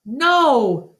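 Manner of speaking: high, loud cry of alarm. A narrator's reading. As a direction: cry out to stop him. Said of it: "Julia cries".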